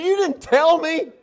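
very high, loud, slow laughing squeal; husband protesting to his wife, high and laughing; indignant protest. Retold or imitated. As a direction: wail it like the wronged party.

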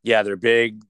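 ordinary talk

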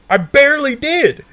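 high-pitched, squawky objection; retold exasperated protest. Answering his stoned friends, high exasperated protest. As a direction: shoot the pitch right up in protest. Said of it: "And I'm like".

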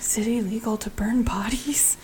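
unvoiced, sheepish whisper; a guilty unvoiced whisper to the group. Player whispers an in-character question.

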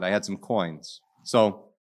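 normal talking voice

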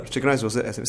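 mildly offhand, bare demand; curt order. Retold or imitated. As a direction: imitate a brusque customer ordering. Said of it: "Some people just do that".

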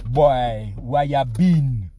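slow Caribbean drawl; Caribbean neighbor. Retold or imitated. Neighbor asks where he's been, slow Caribbean accent. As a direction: stretch it out like the neighbor.

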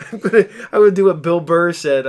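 ordinary talk

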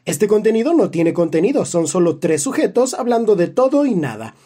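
advertencia leída con voz de anuncio, fuerte; voz de locutor. Acted. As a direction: anuncia como locutor de radio. (locutor de radio solemne)

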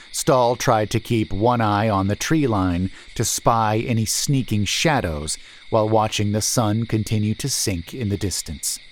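plain reading voice

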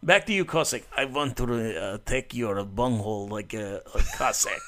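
hammed-up Russian guy accent, held for the bit. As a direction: keep the joking Russian voice going. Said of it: "that is a good accent".

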